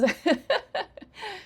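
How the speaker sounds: loud, high giggling; real burst of high, loud laughter; amused laugh. Real, not acted. Teacher laughing after her mock scolding, high giggle.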